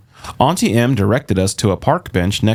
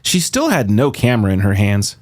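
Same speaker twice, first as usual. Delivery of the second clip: plain reading voice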